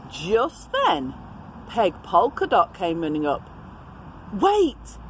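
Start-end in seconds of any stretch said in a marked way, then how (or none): 4.3-5.0 cry out. Acted. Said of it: "she cried".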